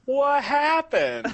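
hazy puzzled dream recounting. Acted. Waking groggy after passing out.

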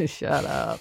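quiet, laughing "Shut up"; a soft pretend telling-off; soft mock shut up. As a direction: murmur "Shut up" through a laugh. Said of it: "I'd love to be able to tell you to shut up".

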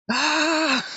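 high startled shout; startled yelp. Retold or imitated. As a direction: yelp like Drax getting a shock. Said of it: "and he's like".

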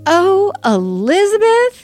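a mother at the end of her rope, stretching the name; exasperated cry. Retold or imitated. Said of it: "The exasperation in her voice".

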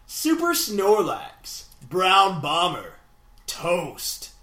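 slow names with big swooping pitch; over-the-top mock-epic roll call; epic announcer. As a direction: draw out each name as if crowning champions. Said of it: "That was way too epic".

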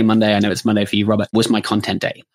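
ordinary talk

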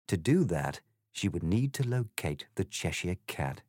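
ordinary delivery, nothing marked

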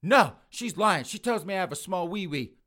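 high, loud indignant denial; angry protest. Acted. Son protesting the doll's lies to mother, angry. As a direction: wail the objection loudly.

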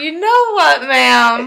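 loud, high, slow sass; mock-outraged comeback; indignant retort. Acted retort to a rude stranger.

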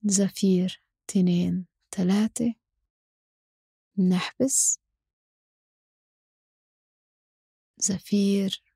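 slow, calm breath counting; guided breathing count. Acted. Counting breath cycles slowly. (عدّ التنفس بهدوء وببطء)

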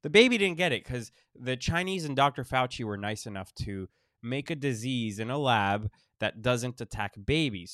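ordinary talk, nothing marked

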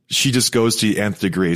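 ordinary talk, nothing marked

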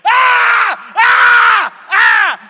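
loud high terrified screaming; wild high shrieks of terror; panicked screams. Pretend. Panicking at party inside.

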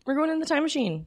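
high, sing-song excitement; high playful echo. Acted. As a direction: squeal it like a kid. Echoing time machine bit.

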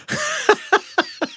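a sharp, high laugh of exasperation; high, exasperated laugh. Real, not acted. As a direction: burst of incredulous laughter. Laughing at an annoying comment.

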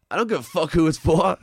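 retold gangster brushing the kid off; mock tough-guy snarl. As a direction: snarl it back like a wiseguy.

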